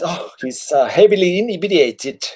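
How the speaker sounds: high, haughty nobleman; high haughty noble scolding War Turtle; sniffy husband. Acted. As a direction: look down on the drunk. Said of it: "he says".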